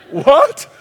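high, loud incredulous yelp; baffled outburst played for laughs; exaggerated astonishment; mock disbelief.

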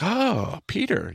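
high, drawn-out admiring "oh"; lightly acted delight, pitch leaping up at the start; impressed exclamation, gently acted; Jesus. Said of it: "But Jesus is like".

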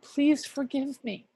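understated imitation of a crying husband's plea; retold tearful plea. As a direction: retell him begging gently for forgiveness. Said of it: "to cry".

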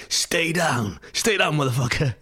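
snapping a retold threat at a stranger; imitating a thug barking orders; tough street guy. Bullwhip man yelling at him.

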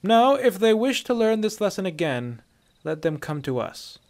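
loud, high start settling to firm; strong officer's voice; commanding Imperial officer. A narrator's reading. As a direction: give orders with authority.

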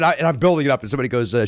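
ordinary delivery

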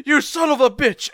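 high, loud mock-furious outburst; mock outrage. Podcaster quoting a show character, mock-furious shout. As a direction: shout it as an exasperated quote. Acting out Avdol's angry outburst.

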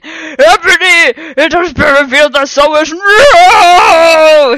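very slow, high, loud hammed-up wizard cry; shouted. A narrator's reading. Dumbledore shouting at Snape and Lupin, slow and loud. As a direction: drag it out like a shouting old wizard. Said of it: "he shouted".

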